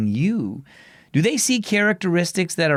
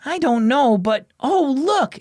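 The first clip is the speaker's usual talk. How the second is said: lightly voiced girl, higher and eager; a girl suddenly spotting something fun; sudden excited call from a young girl; excited exclaim; 10 semitones higher, 3 dB louder than usual. A narrator's reading. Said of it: "exclaimed Nova".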